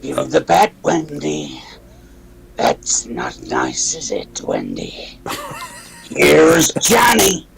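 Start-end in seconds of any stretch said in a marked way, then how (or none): 2.6-5.2 very low, slow, soft menacing creep; menacing Jack Torrance. Pretend. Jack Torrance taunting Wendy, very low and slow. 6.1-7.5 slow, louder grinning taunt; Here's Johnny. Pretend. Jack Torrance breaking through the door, loud and slow. Iconic Shining line.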